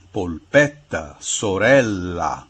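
polpetta, sorella slowly, each ending in A; Italian final A. Shown as a demonstration. Professor lists words ending in A, Italian final A. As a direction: draw out the double consonants in polpetta, sorella. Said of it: "Se a palavra termina em A".

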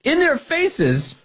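slow, high sneering drawl; mocking. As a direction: stretch it out in mock disdain. Mocking activist in faces.

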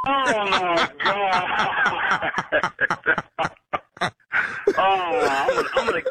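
long drawn-out groan breaking into laughs; relieved laughter. Real, not acted. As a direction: let the shock collapse into real laughter. Victim learns it was a prank.

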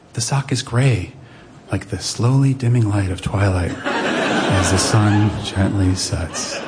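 slow, low bedtime-story drone; audio Ambien. Retold or imitated. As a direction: lull a child to sleep, drawing out each word. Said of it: "I became audio Ambien".

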